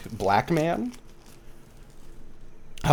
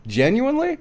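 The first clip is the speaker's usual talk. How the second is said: surprised question; 12 semitones higher than usual. Retold or imitated. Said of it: "I was like".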